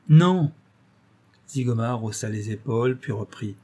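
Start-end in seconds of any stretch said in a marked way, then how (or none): 0.1-0.5 a sharp, louder "Non"; firm refusal. Acted. Broquet refusing the bandit, sharp loud no. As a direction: shut him down in one word.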